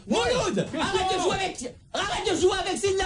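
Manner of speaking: put-on scolding a kid. Prankster scolds a pretend child.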